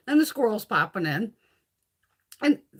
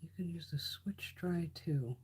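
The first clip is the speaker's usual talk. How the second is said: whispering; 4 semitones lower, 14 dB quieter than usual. Real, not acted. Whispered aside to viewers.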